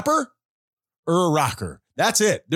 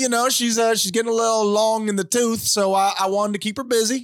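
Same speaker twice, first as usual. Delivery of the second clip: put-on Southern accent. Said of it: "It's not a terrible Southern accent".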